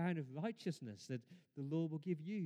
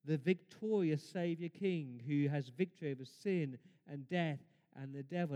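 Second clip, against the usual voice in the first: usual speaking voice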